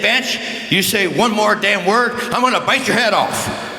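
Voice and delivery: lightly voiced angry umpire; umpire's threat. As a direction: bark the warning at Bench.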